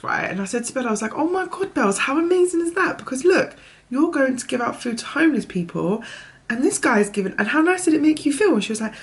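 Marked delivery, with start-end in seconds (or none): 1.1-8.5 much higher, thrilled and eager; excited mum. Retold or imitated. Mother thrilling her daughter with the stranger's kindness, excited. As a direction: lift every phrase with amazed joy.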